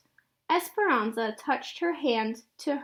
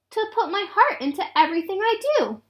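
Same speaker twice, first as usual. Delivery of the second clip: put-on earnest little girl; 7 semitones higher, faster than usual. Girl answers Mama earnestly.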